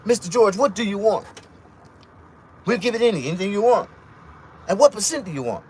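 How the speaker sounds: high, loud, fawning banker voice; lightly voiced fawning bank clerk; eager banker. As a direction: play the overeager banker bending over backwards. Retold eager bank offering.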